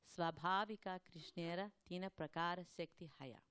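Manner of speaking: measured Bengali scripture recitation; verse recitation. Acted. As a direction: recite the sacred verse line by line.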